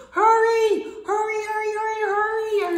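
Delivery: high and loud, pressing; urgent. Acted. Bear urging Mole to hurry after the kite. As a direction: push your friend to run. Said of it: "urged Bear".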